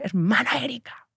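scolding church sister. Retold or imitated. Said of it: "me agarra ... y me dice".

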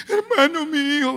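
high, pleading cry; mother begging the brother for help. Retold or imitated. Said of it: "la hermana gritando".

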